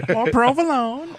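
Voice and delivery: high, slow mock Italian-American voice; Italian impression. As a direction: stretch it out in a teasing Italian accent. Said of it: "such a racist Italian impression".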